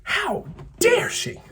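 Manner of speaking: theatrical high-pitched indignation; mock outrage. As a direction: stretch "dare" up into a squeal of mock offence. Outraged at Riley.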